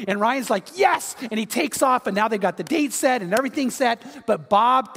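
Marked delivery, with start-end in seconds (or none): 0.8-1.1 high, triumphant retold cheer; elated yes. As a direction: let the joy jump up in pitch. Retelling Ryan's delighted reply.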